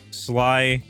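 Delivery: ordinary talk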